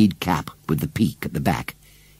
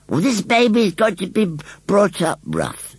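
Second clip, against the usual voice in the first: high rustic scarecrow voice; stubborn rustic scarecrow; 12 semitones higher than usual. A narrator's reading. Scarecrow declares how baby will learn, stubborn. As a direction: lay down the law as a scarecrow.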